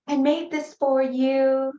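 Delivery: put-on sweetly offering a gift as a child; daughter's voice. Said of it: "And she's".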